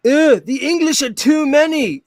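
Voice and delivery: high, slow mock Scottish; Braveheart Scottish accent. Shown as a demonstration. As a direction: do a bad movie Scot. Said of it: "I could do Scotland".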